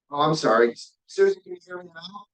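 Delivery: normal talking voice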